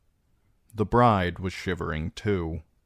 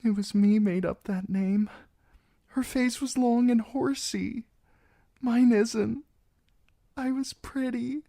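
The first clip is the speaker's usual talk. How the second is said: put-on high, girlish and anxious; a frightened young bride trying to sound brave; nervous young bride pleading her case.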